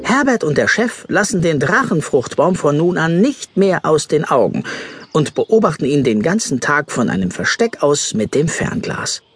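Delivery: ordinary talk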